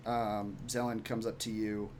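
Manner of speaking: ordinary talk, nothing marked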